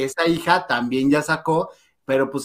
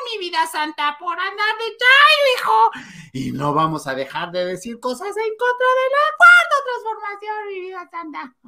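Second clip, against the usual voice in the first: mock sugary scolding. Mocking Gustavo, high sugary sing-song scolding. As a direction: coo it like a sarcastic auntie. Mocking sweet-talk to commenter.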